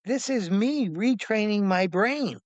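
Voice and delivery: fervent retold declaration; emphatic insistence. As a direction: drive each point home, voice up. Continues acted insistent reply.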